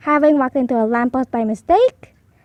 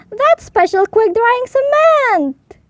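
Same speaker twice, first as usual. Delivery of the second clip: shocked; 9 semitones higher, 4 dB louder, slower than usual. A narrator's reading. Foreman shocked.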